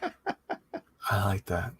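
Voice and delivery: chuckling through the words; laughing. Real, not acted. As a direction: laugh it out softly. Laughing repeat.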